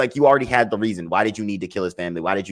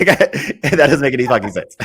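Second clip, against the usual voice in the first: laughing repeat; 7 semitones higher, 7 dB louder than usual. Real, not acted.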